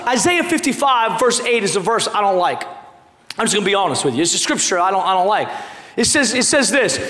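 normal talking voice

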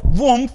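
sudden loud imitated swipe; loud sword whoosh. Peter swinging his sword at the priest, loud whoosh. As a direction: make the blade's swoosh with your voice.

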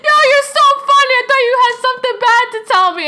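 loud, very high and fast, as if cracking up; imitating laughing dad. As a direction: play a dad in hysterics. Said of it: "Why is he laughing?".

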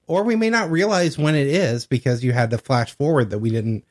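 normal talking voice

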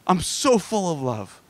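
slow, drawn-out imitation of grandpa; a hint of grandpa's heartfelt insistence; grandpa repeating himself. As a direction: insist with long, falling emphasis. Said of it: "He just kept repeating".

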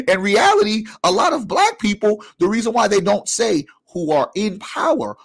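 fired-up high-pitched insistence; worked up. Real, not acted. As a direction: pitch jumps way up, heated and emphatic. Heated point, pitch soaring.

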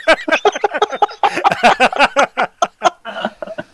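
long, high burst of laughter; real laughter. Real, not acted. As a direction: crack up helplessly, high-pitched. Cracking up at the player's line.

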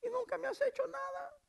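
very high, whiny mock complaint; hammed-up high-pitched grievance; sulking older son. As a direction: complain to papi like a jealous child. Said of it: "El otro hijo se enojó y dijo".

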